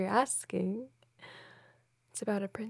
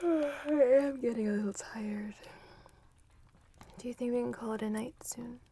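whispering. Acted. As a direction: breathe it close and hushed. Whispered girlfriend roleplay.

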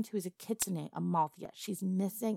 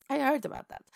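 higher, fast laughing gossip; laughing tease; 8 semitones higher, faster than usual. Acted. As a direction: giggle through the jab. Madam mocks goblin's kidnapping.

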